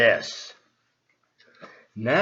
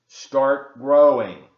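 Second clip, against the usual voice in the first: slow command; 7 dB louder, much slower than usual. Acted. Toad commands seeds, very slowly.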